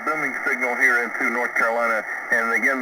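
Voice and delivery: ordinary delivery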